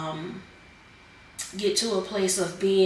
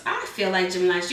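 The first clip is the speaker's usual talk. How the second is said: normal talking voice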